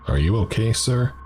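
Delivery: concerned cop. Acted. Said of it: "the cop asked me with concern".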